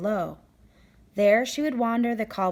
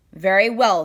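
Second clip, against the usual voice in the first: loud, sudden royal pronouncement; the angry king answers the quilt maker loudly; hammed-up king; 9 dB louder than usual. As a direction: boom it out like a king who won't be refused. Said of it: "the king replied".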